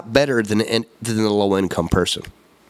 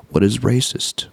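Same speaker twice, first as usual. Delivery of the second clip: normal talking voice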